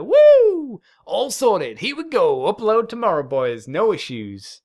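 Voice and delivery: whooping high and loud, then happy loud rattle-off; excited cheer. Retold or imitated. Podcaster cheering over a quick edit, loud and whooping. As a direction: celebrate the easy upload like a win. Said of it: "so it's like".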